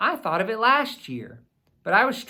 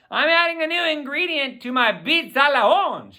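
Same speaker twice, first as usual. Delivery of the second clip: lightly voiced cheerful dad, higher; dad calling out; 9 semitones higher, 3 dB louder than usual. A narrator's reading. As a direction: call it out from the kitchen, pleased. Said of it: "he calls from the kitchen".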